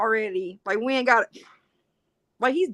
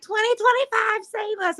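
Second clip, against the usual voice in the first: high, whiny mock plea; mock panicked liberals; 12 semitones higher, slower than usual. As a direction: mimic people begging to be rescued. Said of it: "they're all like".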